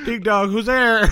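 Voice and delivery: high, loud, laughing. Pretend. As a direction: giggle through the joke. Joke doorbell line, laughing.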